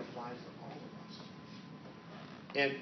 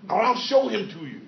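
higher, louder eager insistence; excited Rafiki; 9 semitones higher, 12 dB louder than usual. Acted. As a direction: burst out with the good news. Rafiki offering to show Mufasa.

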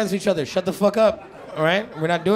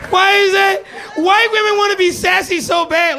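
mock outrage; 10 semitones higher, 4 dB louder than usual. Real, not acted. Mock outrage at sassy women.

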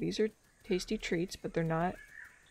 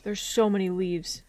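normal talking voice

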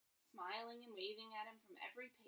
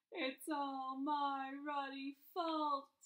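loud, high, drawn-out acted wail; sobbing; 5 semitones higher, 9 dB louder, much slower than usual. A narrator's reading. Giant visitor blaming himself at Harry's bedside, sobbing. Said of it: "he sobbed".

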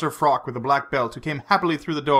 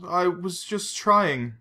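higher, halting and slow; stung, hurt; 5 semitones higher, much slower than usual. A narrator's reading. Vesper stung by Bond's bitterness, hurt and faltering. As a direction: falter through a wounded self-defence. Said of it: "Vesper was stung by the bitterness".

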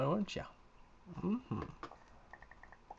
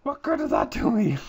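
loud complaint; 6 semitones higher, 8 dB louder than usual. Real, not acted. Complains loudly at cow.

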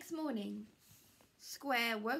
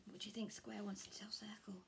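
whispered aside; 8 dB quieter, faster than usual. Real, not acted. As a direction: lean in and whisper the question. Reader asks listening children.

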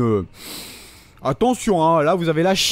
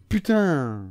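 usual speaking voice

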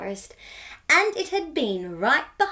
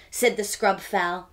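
usual speaking voice

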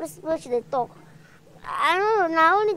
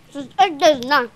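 loud scolding; 2 semitones higher, 7 dB louder than usual. Acted. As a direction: nag him hard to come eat. Wife scolds husband about eating.

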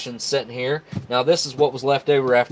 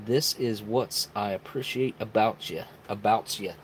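slow, soft attempt at a Canadian accent; 2 semitones lower, 7 dB quieter than usual. Streamer reading Smitty's super chat message, mock Canadian voice. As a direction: read it slowly with a hint of Canadian. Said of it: "supposed to read that in my best Canadian voice".

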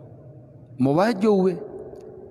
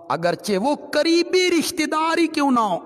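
rising emphasis; 10 semitones higher than usual. Real, not acted. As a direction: voice climbs high on करीबी. Preacher stressing close relatives.

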